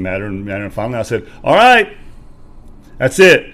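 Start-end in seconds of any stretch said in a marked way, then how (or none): 1.4-3.5 loud, high-pitched burst of anger; fed-up yell at a friend who's quitting; retold angry outburst. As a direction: explode at your friend. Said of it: "I'm getting madder".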